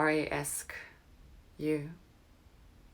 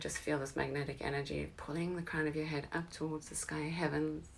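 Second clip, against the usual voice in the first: usual speaking voice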